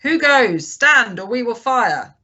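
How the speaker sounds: loud, high sentry's challenge; hailing challenge. A narrator's reading. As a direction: shout a warning to halt. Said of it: "Then he hailed the buccaneer".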